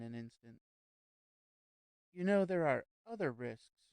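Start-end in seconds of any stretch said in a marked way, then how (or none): 2.1-3.9 blurted out abruptly; sudden. Acted. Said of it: "she said suddenly".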